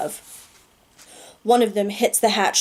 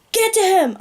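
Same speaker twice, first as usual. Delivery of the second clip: scream; 11 semitones higher than usual. Acted. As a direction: scream it over the chaos. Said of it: "I scream again".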